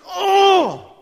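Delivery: loud high cry of frustration; anguished cry. Acted. Preacher voices hidden despair, loud anguished cry. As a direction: burst out in mock desperation. Acts out inner desperation.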